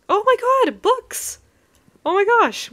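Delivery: high, loud squeal of delight at "books"; excited squeal. Real, not acted. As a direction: shriek it like finding treasure. Finds a library in game.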